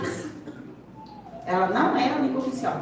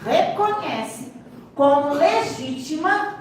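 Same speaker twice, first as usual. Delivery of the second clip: slow, higher, each word set apart; slow emphatic reading; 4 semitones higher, slower than usual. Real, not acted. Teacher reads the law to the class, slow and emphatic. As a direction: read it out slowly, stressing each word.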